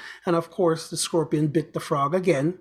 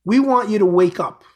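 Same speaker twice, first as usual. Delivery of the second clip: louder, driving the words home; emphatic wake-up call; 3 semitones higher, 7 dB louder than usual. Real, not acted. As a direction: call them awake with force. Preaching wake-up call to listeners.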